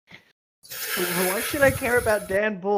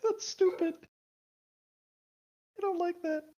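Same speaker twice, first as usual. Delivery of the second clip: whiny disgust; 13 semitones higher, 4 dB quieter than usual. Real, not acted. Reacting to pun.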